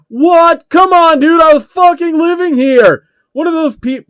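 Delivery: outraged shout. Real, not acted. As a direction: yell it at the screen, cheated. Game death while streaming.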